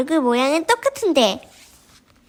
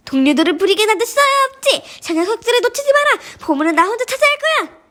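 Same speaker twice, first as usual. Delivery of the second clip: high, heated boss ordering a chase; rally henchmen with raised pitch; greedy acted villain cry; villainous commanding; 8 semitones higher, 3 dB louder than usual.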